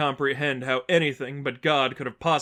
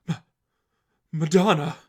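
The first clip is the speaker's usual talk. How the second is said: awestruck gasp; 4 semitones higher, 4 dB louder than usual. Acted. Lester awestruck at Stephanie.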